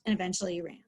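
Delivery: ordinary delivery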